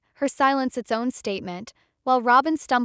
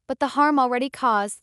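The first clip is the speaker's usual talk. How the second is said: ordinary delivery, nothing marked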